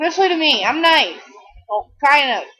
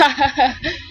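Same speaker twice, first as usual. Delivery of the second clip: short rising giggle; her own laugh. Real, not acted. Laughing at herself while rambling to camera. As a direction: let out a real goofy laugh. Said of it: "My laugh is the stupidest laugh".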